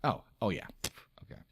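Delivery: ordinary talk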